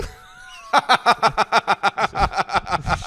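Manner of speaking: long, high, loud cackling laugh. Real, not acted. Teller laughs at the robber worrying she'll get robbed, long loud laugh. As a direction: laugh helplessly.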